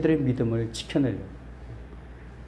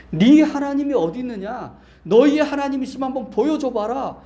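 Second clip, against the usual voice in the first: raised, louder jeering demand; acted challenge; 10 semitones higher, 6 dB louder than usual. The world challenges believers about their God, acted taunt. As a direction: throw it down as a taunting dare. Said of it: "이런 소리를 우리가 듣는".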